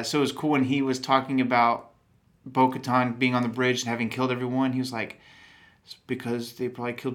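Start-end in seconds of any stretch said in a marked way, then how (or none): none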